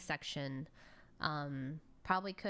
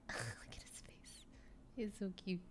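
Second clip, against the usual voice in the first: overwhelmed by cuteness; 8 dB quieter than usual. Real, not acted. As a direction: melt over a cute little animal. Whispering over cute pet.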